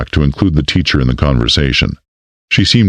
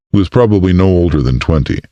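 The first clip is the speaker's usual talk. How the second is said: usual speaking voice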